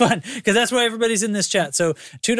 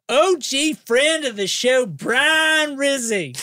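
high, very slow Kentucky drawl; sing-song, stretched Kentuckian accent; 8 semitones higher, much slower than usual. Pretend. Host finishing the supporter list on request, high Kentuckian drawl. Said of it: "do it with a Kentuckian accent".

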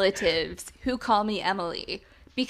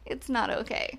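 quieter repeat; 6 dB quieter than usual. Real, not acted. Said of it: "repeated, fading".

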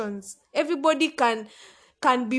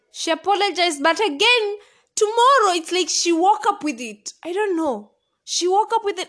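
exasperated; 6 semitones higher, 5 dB louder than usual. Real, not acted. Exasperated retelling.

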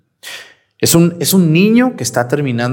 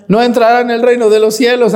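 booming warning; 7 semitones higher, 4 dB louder than usual. Acted. Quoting Christ's warning to congregation.

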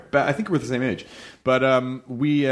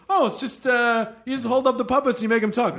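naive dismissiveness; 9 semitones higher than usual. Retold or imitated. Voices naive puppet novice.

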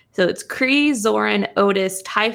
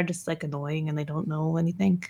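normal talking voice

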